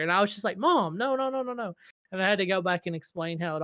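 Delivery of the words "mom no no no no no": mock protesting. Streamer retells calming mom's lecture, mock protesting. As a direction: retell heading off mom in a mild panic.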